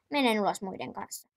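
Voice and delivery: ordinary talk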